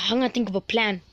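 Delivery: normal talking voice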